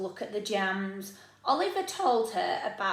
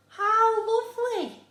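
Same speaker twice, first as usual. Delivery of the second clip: much higher, louder delighted mum; Mum's delighted reply; 12 semitones higher, 7 dB louder than usual. Acted. Mum delighting in her son's story, high and loud. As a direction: gush it like a pleased mother. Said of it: "said Mum".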